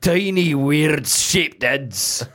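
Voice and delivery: put-on a lightly done Scottish accent breaking into laughs. A host trying Scottish for the accent game names the bush baby, laughing, in a light Scottish accent. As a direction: push through a Scottish brogue while giggling. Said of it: "can I do Scottish?".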